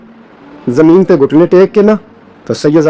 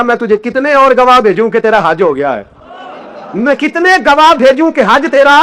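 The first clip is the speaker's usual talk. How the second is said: high, ringing sermon voice building up; impassioned preacher; preaching at full force; 6 semitones higher than usual. Acted. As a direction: preach it out, climbing with each line. Emotional climax of sermon.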